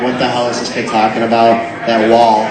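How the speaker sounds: ordinary delivery, nothing marked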